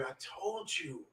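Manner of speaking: very soft, drawn-out told-you-so; quiet reproach. Retold or imitated. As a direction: murmur a slow, imitated reproach. Mother's quiet reproach, retold.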